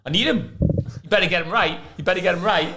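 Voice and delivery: high-pitched mock-angry ultimatum; hammering, imitated. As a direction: lean on the physio like an irate manager. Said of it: "would have come in hammering them".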